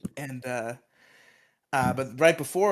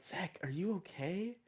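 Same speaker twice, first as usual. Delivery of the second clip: soft concern; 6 semitones higher, 12 dB quieter than usual. Retold or imitated. Kim whispering concern.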